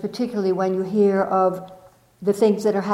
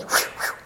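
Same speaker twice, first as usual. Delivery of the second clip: breathy whew whew like a bird flying off; crow whoosh; 5 dB quieter than usual. Retold or imitated. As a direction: blow out the whew like a crow taking off. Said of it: "And the crow just goes".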